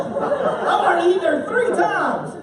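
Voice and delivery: building from laughing excitement to a high shout on three times; excited laughing. Real, not acted. Preacher confessing his bacon habit with laughing excitement. Raving about thick bacon.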